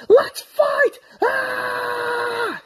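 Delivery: high shouted rally; battle cry. Retold or imitated. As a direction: roar into the fight. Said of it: "and you're like".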